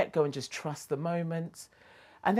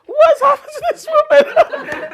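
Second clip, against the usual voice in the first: amazed disbelief; 17 dB louder than usual. Retold or imitated. Amazed at mum's change.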